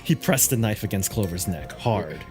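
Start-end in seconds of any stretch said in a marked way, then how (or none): none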